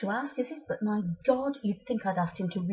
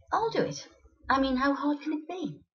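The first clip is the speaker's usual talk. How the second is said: higher-pitched retold bravado; airily volunteer, pitched up; breezy confidence; 6 semitones higher than usual. Woman volunteering for the DIY job herself, breezy confidence.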